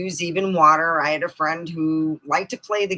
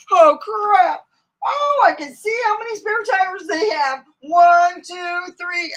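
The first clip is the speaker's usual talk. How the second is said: mock panic by semis; 13 semitones higher, 7 dB louder than usual. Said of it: "and you're like".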